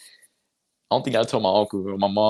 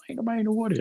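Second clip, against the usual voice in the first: surprised; 12 semitones higher, 4 dB quieter, much faster than usual. Retold or imitated. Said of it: "I'm like".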